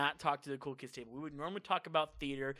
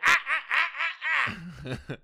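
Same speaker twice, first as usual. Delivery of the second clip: high, loud, slow teasing laugh; mock coach laughter; 10 semitones higher, 8 dB louder, much slower than usual. Imitating the coach laughing at a student leaving. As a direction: cackle like the coach mocking the class. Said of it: "Coach Williams would be like".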